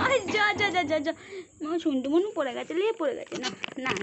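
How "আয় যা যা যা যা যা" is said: laughing shoo. Real, not acted. Shooing with laughter.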